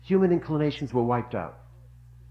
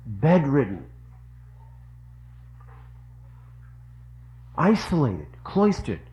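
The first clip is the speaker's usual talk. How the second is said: passionate preaching; 4 semitones higher, 5 dB louder than usual. Real, not acted. Preacher swells with feeling.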